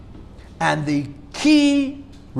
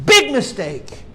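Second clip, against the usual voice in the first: raised, higher voice of an upset Rebbe; 7 semitones higher, 5 dB louder than usual. Retold or imitated. Quoting the Rebbe's upset phone outburst, raised and higher. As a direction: hammer it home, upset and loud. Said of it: "he's so upset".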